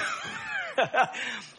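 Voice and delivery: high burst of laughter at his own joke. Real, not acted. Preacher laughing at his professor joke, high and bright.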